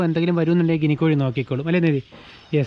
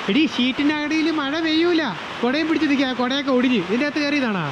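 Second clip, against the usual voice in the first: teasing a pet; 8 semitones higher than usual. Real, not acted. Teasing a bird under the sheet.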